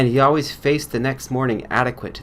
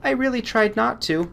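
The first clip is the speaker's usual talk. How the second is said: put-on higher girl's voice, apologetic; apologetic young girl; 13 semitones higher than usual. Answering-service girl apologizes to the doctor, higher and apologetic. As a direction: excuse yourself meekly.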